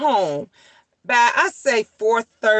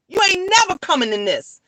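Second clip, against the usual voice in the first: higher and fast, hammering the point; emphatic repeat; 6 semitones higher, faster than usual. Real, not acted. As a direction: say it again, pushing harder and quicker. Repeats line, then louder.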